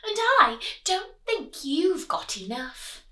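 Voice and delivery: high taunting piglet; mock the wolf; smug little pig; cheeky pig. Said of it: "pig said".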